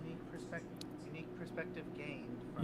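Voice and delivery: distant, hollow, muffled mic; down a well. Real, not acted. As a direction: voice echoing from far off. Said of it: "your mic is having you sound like you're down a well".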